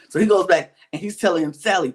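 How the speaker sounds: ordinary delivery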